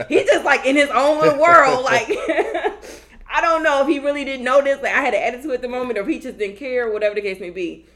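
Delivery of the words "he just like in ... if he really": high, loud laughing words. Real, not acted. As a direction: laugh through the telling. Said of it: "laughing while telling".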